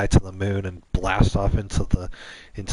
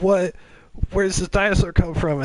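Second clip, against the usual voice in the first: scared cry; 11 semitones higher than usual. Acted. Couple scared by dinosaur.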